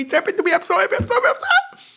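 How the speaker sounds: imitating an overjoyed friend squealing with laughter; giddy joy. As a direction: imitate her giddy rising delight. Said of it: "She told me".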